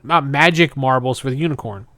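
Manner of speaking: usual speaking voice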